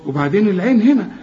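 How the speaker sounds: normal talking voice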